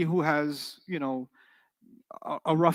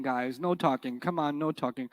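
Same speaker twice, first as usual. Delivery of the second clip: quiet, lower, weak "no talking"; soft, lower no talking; 4 semitones lower, 7 dB quieter than usual. Shown as a demonstration. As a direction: plead mildly with the class. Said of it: "different level".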